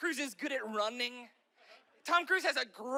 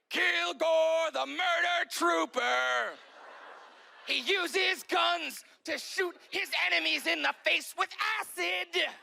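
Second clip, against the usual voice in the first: put-on boys' toy commercial; 4 semitones higher, slower than usual. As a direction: roar it like an extreme action-figure ad. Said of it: "It's always like".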